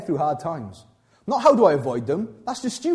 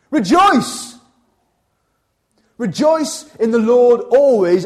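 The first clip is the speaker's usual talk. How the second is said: much higher and louder, then slow and drawn out; loud proclamation; 6 semitones higher, 7 dB louder, much slower than usual. Shown as a demonstration. Cry it out like a summons to the whole room. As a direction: pastor booming Paul's command at the congregation, loud proclamation. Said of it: "I'll say it again".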